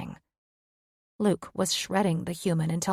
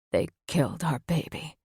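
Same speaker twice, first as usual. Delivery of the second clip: grieving mate; 3 semitones lower than usual. Acted. Luke voiced, grieving.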